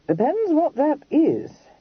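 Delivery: slow, wary and guarded; cautious. A narrator's reading. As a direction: hedge it suspiciously. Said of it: "he said cautiously".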